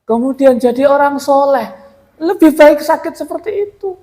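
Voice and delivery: high, loud and fervent. Real, not acted. Telling the guest why illness beats a quick cure, fervent and high. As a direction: lift it up like a sermon.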